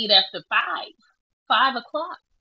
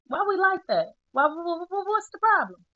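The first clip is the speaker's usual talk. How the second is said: much higher, stammering in exasperation; exasperated; 10 semitones higher than usual. Real, not acted. Vlogger scolding herself about eating, high and flustered. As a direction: throw up your hands at yourself, squeaky and flustered.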